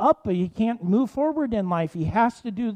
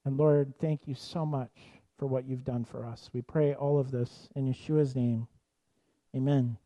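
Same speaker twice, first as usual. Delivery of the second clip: prayer; 3 semitones lower than usual. Acted. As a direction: pray it gently over the listeners. Leads a closing prayer.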